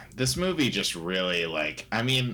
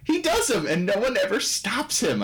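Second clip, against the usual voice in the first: ordinary delivery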